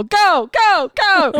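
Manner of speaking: high-pitched mock yelling; hammed-up frantic urging of the kids. Acts urging kids downhill.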